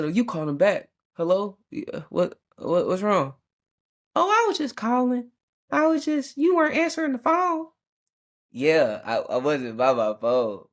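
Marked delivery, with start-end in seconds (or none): 1.1-3.3 mildly alarmed answer. Retold or imitated. Answering worried call back. 4.2-7.7 high, put-on voice of the calling grandma; squeaky imitation of a relative who keeps calling; mystery accent. Playing the grandma calling over and over, high put-on voice. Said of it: "and I don't know what accent that was".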